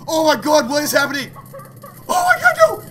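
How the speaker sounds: loud, high shrieks of fright; frantic, high-pitched terror; panicked screaming. Real, not acted. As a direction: scream and plead for it to leave. Jump scare.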